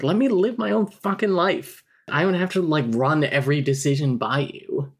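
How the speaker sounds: high-pitched, hammed-up exasperation; exasperated protest. [name] telling her family off, acted exasperation.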